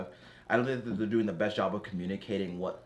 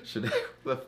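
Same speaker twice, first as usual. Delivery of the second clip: giggling through the words; can barely finish for laughing; 6 semitones higher than usual. Real, not acted. Laughing at a shared image.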